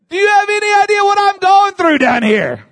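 high, loud, ringing preacher cry; full-force preaching. Acted. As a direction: lift the whole church with it.

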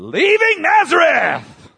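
high, loud pulpit shout; exultant preacher's holler; full-force preaching. Real, not acted. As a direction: proclaim it to the whole congregation.

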